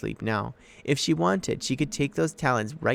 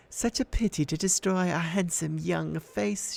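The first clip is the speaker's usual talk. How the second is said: high, soothing menace; soothingly; 7 semitones higher than usual. A narrator's reading. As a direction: lull him with false kindness. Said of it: "she told me soothingly".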